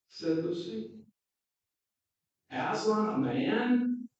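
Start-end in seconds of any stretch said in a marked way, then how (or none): 2.5-4.1 higher, louder, slow stern disbelief. Acted. Mr Beaver correcting Lucy, slow, stern and louder. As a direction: scoff sternly at the idea. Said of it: "Mister Beaver said sternly".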